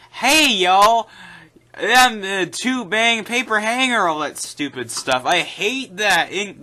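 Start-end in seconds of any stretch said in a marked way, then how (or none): 0.1-4.1 loud, high, hammed-up southern drawl; exaggerated southern twang, mocking the dub; mock southern accent. Critic mocking a dubbed character he hates, exaggerated southern accent. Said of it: "The southern accent".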